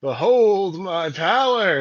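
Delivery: high, loud, slow grand proclamation; pompous booming ogre mage. Acted. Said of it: "is what you're trying to go for here".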